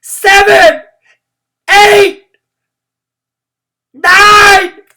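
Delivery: the whole class yells the number; shouted cheer. A narrator's reading. Room nine cheering William on, shouting.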